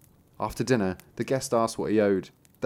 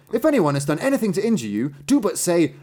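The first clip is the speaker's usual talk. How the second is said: high, loud instruction with storybook relish; emphatic master; 7 semitones higher, 5 dB louder than usual. A narrator's reading. Master explaining the sack to his apprentice, emphatic. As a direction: instruct grandly, pitched up.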